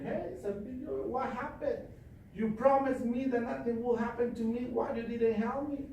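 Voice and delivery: high, aggrieved voice of the drowned man; whining complaint to Saint Peter. Acted. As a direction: plead with Saint Peter, hurt.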